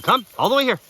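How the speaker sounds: high, loud call to the dog. Real, not acted. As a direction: call her all the way in. Calling dog in.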